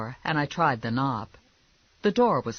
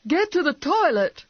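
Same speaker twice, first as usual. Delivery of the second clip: very high, loud urgent order; yelling wife; 7 dB louder than usual. Acted. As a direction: yell it in alarm at your husband. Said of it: "his wife yelled".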